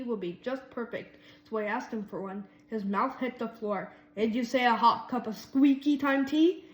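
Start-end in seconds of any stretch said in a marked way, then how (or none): 4.2-6.6 loud, incredulous rising disbelief; can't believe what he heard; shocked repeat. A narrator's reading. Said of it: "he repeated".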